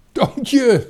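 high, loud call of the name; shouting. A narrator's reading. Friend spots the boy through the bars, high shout. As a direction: cry out to a friend suddenly spotted. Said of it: "schreeuwde Dikkie Druif".

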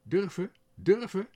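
high, loud outraged protest; indignant crow. A narrator's reading. The crow answers the sparrow's taunt, loud indignant voice. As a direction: bristle with offended pride. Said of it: "zei de kraai verontwaardigd".

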